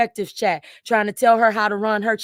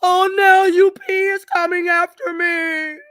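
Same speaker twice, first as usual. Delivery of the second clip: high, drawn-out mock wail; mocking, loud victim voice; playing victim; 7 semitones higher, 6 dB louder, slower than usual. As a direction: whine it slowly like a fake victim. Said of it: "now you're gonna play victim".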